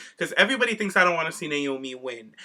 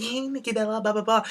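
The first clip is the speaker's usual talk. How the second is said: a quick, higher mock reporter call, softer than her usual voice; mock paparazzi; 6 semitones higher, 4 dB quieter than usual. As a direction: lightly play a pushy interviewer chasing a star. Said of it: "[name]'s all paparazzi".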